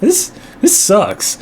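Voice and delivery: mock-sulky verdict on the movie; unimpressed kid. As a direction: drag it out like a bored seven-year-old. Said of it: "I was like".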